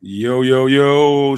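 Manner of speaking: loud, hyped-up yo yo yo; loud hype greeting. Real, not acted. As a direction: shout a hyped hello. Guest greets host loudly.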